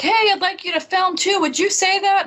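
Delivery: mock-eager, high-pitched offer. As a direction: pitch it way up as a keen retold invitation. Retells producer pitching to husband.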